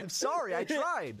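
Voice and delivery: high-pitched defensive plea; protesting apology. Real, not acted. Defending the failed accent to co-host, high protest.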